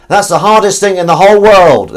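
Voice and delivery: high, loud shout from another room; loud, high-pitched mock complaint; imitated shout. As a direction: holler it as if from next door. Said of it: "shouted from the other room".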